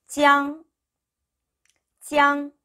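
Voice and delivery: 찌양 held high and level; one long, even high 찌양; teacher's sustained 찌양; model pronunciation. Shown as a demonstration. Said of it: "이라고 발음하시면 됩니다".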